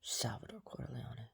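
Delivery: hushed, slow whisper. Real, not acted. Talking to the camera about Salvador, whispered. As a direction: whisper it slowly, drawing the name out. Mutters name after mock questions.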